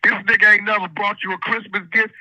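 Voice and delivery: usual speaking voice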